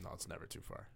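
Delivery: whispered aside, no voice; whispering. Real, not acted. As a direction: breathe it under your breath. Joking aside under breath.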